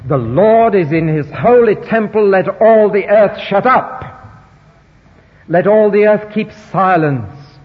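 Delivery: loud, slow solemn declaration; solemn proclamation. Retold or imitated. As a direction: proclaim it slowly, with awe. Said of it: "appropriate attitude to the living God".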